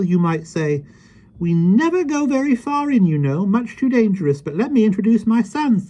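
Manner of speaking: put-on high, lively voice of a small old man; gentle, chatty little fellow; kindly little man. Mister Mo speaks.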